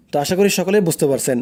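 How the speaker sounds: ordinary delivery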